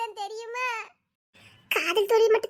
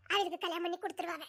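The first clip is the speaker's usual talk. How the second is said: ordinary delivery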